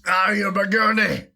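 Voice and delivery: loud, high Scottish taunt; a cheeky Scottish jab mid-chug; loud joking Scottish brogue; mock Scottish accent. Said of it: "impressed with that accent".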